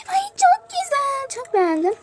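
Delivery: very high, slow, drawn-out gasp of joy; delighted squeal. Real, not acted. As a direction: swoon over the cute outfit. Gasps at new item. (çok tiz, uzatarak hayranlık)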